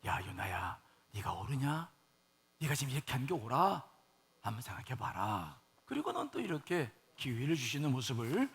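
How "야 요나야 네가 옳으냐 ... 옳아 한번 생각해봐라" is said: low, soft and patient; gentle admonishing. Retold or imitated. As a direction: reason quietly, as God coaxing Jonah. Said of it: "좋은 말로". (낮고 조용하게 좋은 말로 타이르듯)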